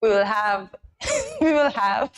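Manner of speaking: trying not to laugh. Real, not acted. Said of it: "I'm laughing at you".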